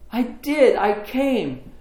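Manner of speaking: high, heartfelt answer in a retold reunion; emotional reply. Brother answering his sister at the party, moved. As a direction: play back an eager, moved confirmation.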